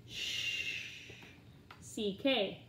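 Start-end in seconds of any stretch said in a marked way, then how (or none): 0.1-1.1 sh as a long voiceless "shhh"; cluster sound. Shown as a demonstration. Teacher drilling consonant clusters, sh as "shhh". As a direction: hold sh with no vowel.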